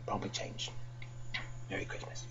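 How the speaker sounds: soft, low fading aside; quiet aside. Real, not acted. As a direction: trail off into a whisper. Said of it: "fading aside".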